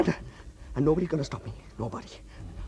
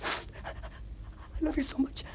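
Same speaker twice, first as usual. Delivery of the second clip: broken whisper; 12 semitones higher, 6 dB quieter, much slower than usual. Acted.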